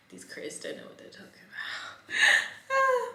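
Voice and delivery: giggling whispered aside; laughing whisper. Real, not acted.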